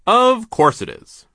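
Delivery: loud, high, drawn-out boss voice; big overbearing mill owner; brusque boss. A narrator's reading. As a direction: boom it out slowly and impatiently. Mill owner overrules his partner.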